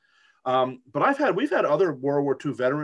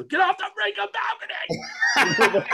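high-pitched panicked mock yell; imitating himself screaming at kids; retold scream. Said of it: "I'll start screaming at them".

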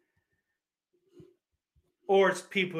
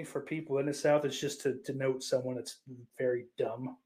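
normal talking voice

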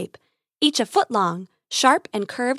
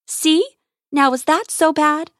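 higher, louder playful cooing; 5 semitones higher, 5 dB louder than usual. Acted. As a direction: tease the animal like a pet. Grace coos at the calmed creature.

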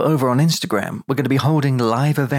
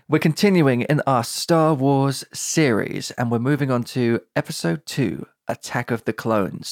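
ordinary talk, nothing marked

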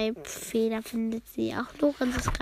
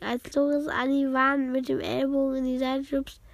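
ordinary delivery, nothing marked

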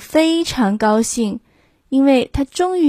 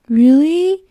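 surprised cow; 5 semitones higher, 5 dB louder than usual. Acted.

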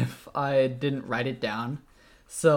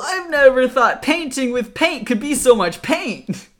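loud, high cheesy ad pitch; eighties commercial voice; 10 semitones higher, 9 dB louder than usual. Acted. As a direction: oversell it like a retro TV ad. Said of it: "imagine this in, like, an eighties commercial".

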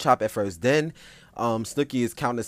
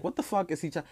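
shocked disbelief; 7 semitones higher, 4 dB quieter than usual. Retold or imitated. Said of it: "So I'm like".

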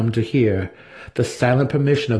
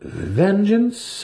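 amused doubt; 11 semitones higher than usual. A narrator's reading. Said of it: "more amused than angered".

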